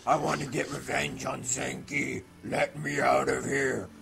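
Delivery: loud, drawn-out villain cry to be let out; trapped demon voice. Acted. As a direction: shout it like a sealed-away demon. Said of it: "you hear this voice that says".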